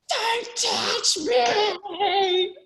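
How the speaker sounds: slow, high, stretched-out cry; warding off the helper with a stretched high cry; desperate warning. Retold or imitated. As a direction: drag each word out high. Exhausted swimmer warning helper.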